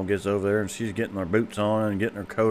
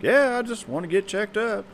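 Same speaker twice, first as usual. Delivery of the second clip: put-on high old papa voice; 12 semitones higher than usual.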